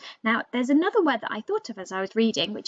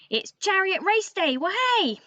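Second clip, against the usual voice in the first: higher and gleeful, ending in a whoop; excited cheer; 9 semitones higher than usual. Shown as a demonstration. Raven restating the day to the children, now thrilled. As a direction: burst out with race-day glee. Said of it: "Or, to put it another way".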